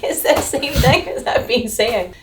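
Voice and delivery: high, slow, laughing "same thing"; exasperated quote through laughter; laughing exasperation. Retold or imitated. Telling viewers about the waitress, laughing exasperation. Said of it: "I was like".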